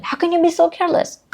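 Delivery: put-on a mom scolding her careless child. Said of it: "something which moms love to say".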